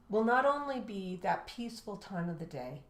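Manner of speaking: ordinary delivery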